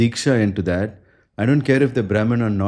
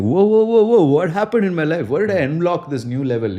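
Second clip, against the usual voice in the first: amazed whoa; 6 semitones higher than usual. Retold or imitated. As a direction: play someone stunned by sudden good fortune. Devotee amazed at sudden grace.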